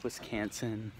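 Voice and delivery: imitated Wisconsin accent, "Wisconsin" with a flat "can" in the middle. Showing viewers the accent everyone has, imitated Wisconsin accent on "Wisconsin". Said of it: "has the accents".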